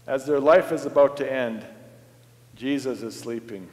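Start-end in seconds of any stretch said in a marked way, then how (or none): 2.5-3.7 hushed repeat. Shown as a demonstration. Preacher repeats the line softer for weight.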